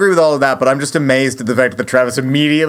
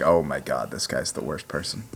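muttered aside; 13 dB quieter than usual. Retold or imitated. Viewer's muttered reaction to bartender.

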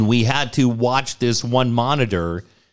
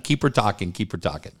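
hushed urging; 4 semitones lower, 5 dB quieter, faster than usual. Retold or imitated. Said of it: "the doctor's like".

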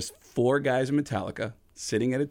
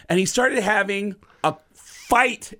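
normal talking voice

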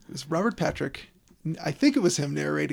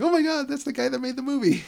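put-on much higher, excited fan with an English accent; higher, starstruck mock fan; 9 semitones higher, 3 dB louder than usual. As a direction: gush like a starstruck London fan. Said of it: "people going".

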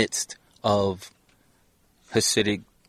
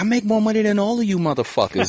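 mock boast; 11 semitones higher than usual. Retold snub of neighbors.

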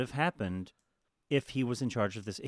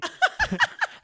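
big high laugh; 6 dB louder than usual. Real, not acted. Friend reading a tweet, bursting out in loud laughter.

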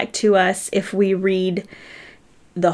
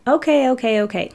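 put-on higher, boyish okay, okay, brushing it off; nephew's grudging okay; 4 semitones higher than usual. Uncle voicing his nephew's grudging okay. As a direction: toss it off like a five-year-old.

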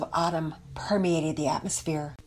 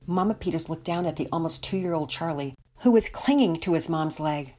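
usual speaking voice

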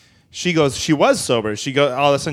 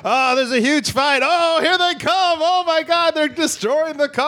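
very high-pitched mock panic; badly faked eyewitness alarm, drawn out; stage a panic for the recording, voice way up; fake alarmed voicemail. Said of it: "I left a voicemail".

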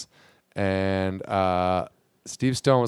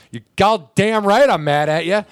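loud, higher, slow mock anger; mock angry; 7 semitones higher, 7 dB louder than usual. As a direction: act out Steve's indignant retort. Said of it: "in the interview, goes".

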